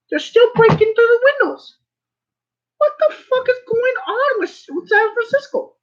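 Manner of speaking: high-pitched incredulous rant; exasperated outrage. Real, not acted. Venting about San Francisco break-ins, high-pitched outrage. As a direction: voice shooting way up in disbelief.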